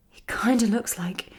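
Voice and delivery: unvoiced dawning whisper; whispered, half to herself. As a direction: let the realisation come out as a whisper. Recognising a face in photo.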